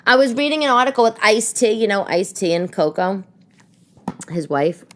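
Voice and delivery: ordinary talk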